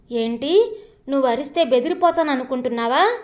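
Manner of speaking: ordinary delivery, nothing marked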